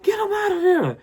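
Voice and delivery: high, shrieking alarmed wife; furious wife. Retold or imitated. Wife yelling at husband to pull niece from the cart, high shriek. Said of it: "His wife said".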